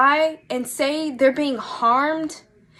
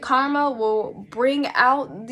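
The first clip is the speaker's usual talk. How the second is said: ordinary delivery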